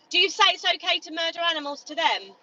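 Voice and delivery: furious retorts thrown back at him; angry shouting. Real, not acted. Activist arguing with a passer-by, furious shout. Heated argument with meat eater.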